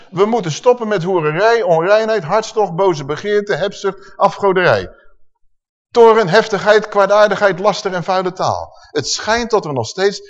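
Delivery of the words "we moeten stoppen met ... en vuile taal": loud, raised list of sins; emphatic preaching. Acted. Preacher drilling the sin list into the congregation, loud. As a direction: hammer each sin home.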